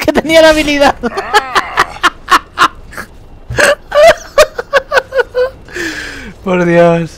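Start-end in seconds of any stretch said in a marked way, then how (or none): 1.0-6.4 high, tearful falling laugh; long crying laugh. Real, not acted. Gamer laughs at his luck, tearful laugh. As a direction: laugh till it sounds like crying.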